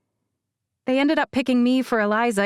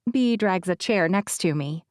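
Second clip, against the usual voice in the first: ordinary delivery, nothing marked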